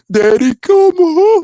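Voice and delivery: high, loud, slow mocking wail; mocking the plea in a high voice, not his own; mocking falsetto. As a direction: stretch it out in a squealing falsetto.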